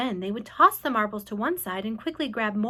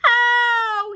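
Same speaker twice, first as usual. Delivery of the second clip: sharp, much higher yelp of pain; pained cry; 12 semitones higher, 8 dB louder than usual. A narrator's reading. Centipede hit on the nose, high loud yelp. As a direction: yelp as the hailstone hits. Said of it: "he cried".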